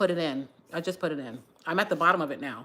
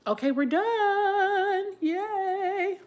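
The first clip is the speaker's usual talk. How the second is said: much higher, slow sing-song yay; cheerful finish; 13 semitones higher, much slower than usual. Real, not acted. Announcing the braid is done, high sing-song cheer. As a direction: celebrate being done, drawn out.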